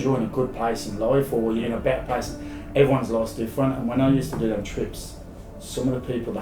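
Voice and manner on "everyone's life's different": ordinary delivery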